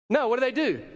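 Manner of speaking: ordinary talk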